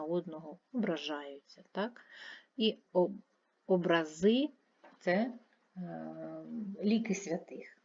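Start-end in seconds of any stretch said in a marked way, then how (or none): 3.7-4.5 образи with a long, rising final -зи; stress on last syllable. Shown as a demonstration. Teacher explaining icons of saints, last-syllable stress on образи. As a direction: land on the end of образи. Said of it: "мають різний наголос".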